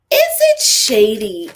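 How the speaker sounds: mocking Robin. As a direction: read it like a sly accuser, slowly. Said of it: "She read the question".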